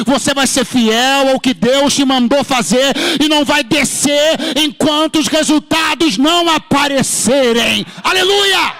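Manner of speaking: high, loud, driving pulpit shout; full-force preaching. Real, not acted. Preacher urging the congregation not to come down the mountain, full-force preaching.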